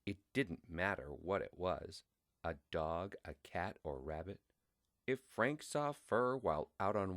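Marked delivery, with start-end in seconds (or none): none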